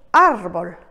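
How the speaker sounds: árbol said in Spanish with the weight on ár; árbol, stressed ár, Spanish vowels. Shown as a demonstration. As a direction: press the first syllable ár of árbol. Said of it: "accent écrit qui nous signalera où porte l'accent tonique".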